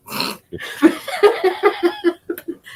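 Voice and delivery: long high giggling laugh. Real, not acted. Laughs at story.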